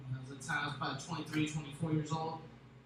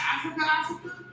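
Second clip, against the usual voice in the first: retold disbelief; 8 semitones higher than usual. Told she is from Africa.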